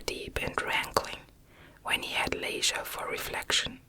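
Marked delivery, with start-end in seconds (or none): none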